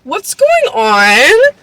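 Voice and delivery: slow, high, swooping disbelief; drawn-out bewildered wail; exaggerated bafflement. Real, not acted. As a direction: stretch it out, utterly baffled. Exasperated bafflement at the story.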